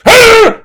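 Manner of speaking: sudden high, loud scream; mock yell. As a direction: imitate the wife's startling outburst. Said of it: "she yells at me".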